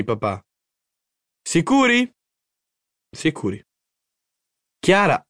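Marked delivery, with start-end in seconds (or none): 1.4-2.1 very high, excited question; high, rising eager query; eager checking. A narrator's reading. Boy presses parents for certainty. 3.2-3.6 lower, settled answer; low, assured reply; calm confirmation. A narrator's reading.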